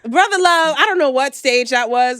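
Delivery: loud, high disbelief; loud incredulous. Real, not acted. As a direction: exclaim in mocking disbelief. Mocking [name]'s Brother Love.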